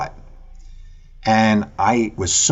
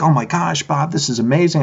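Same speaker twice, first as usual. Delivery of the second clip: excited; 8 semitones higher than usual. Retold or imitated. Excited reply to old friend.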